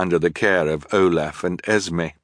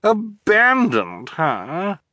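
high, stretched-out question; delighted villainess disbelief; incredulous echo; 11 semitones higher, much slower than usual. A narrator's reading. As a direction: repeat it slowly in a high, affected voice. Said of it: "Esme repeated".

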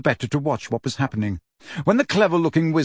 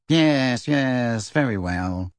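bored voice; 2 semitones lower, much slower than usual. Acted. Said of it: "in a bored voice".

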